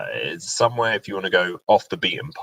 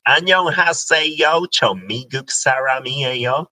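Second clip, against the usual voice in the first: loud, high Korean with an American accent; 8 semitones higher, 7 dB louder, much faster than usual. Shown as a demonstration. Host showing how foreigners sound, loud American accent. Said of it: "they do speak with that accent".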